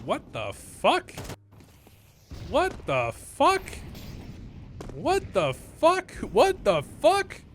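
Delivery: slow, drawn-out, rising high; stretched out in shocked disbelief; stunned disbelief. Real, not acted. Baffled by the smoke grenade.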